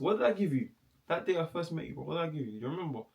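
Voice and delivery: talking to the pet. Real, not acted.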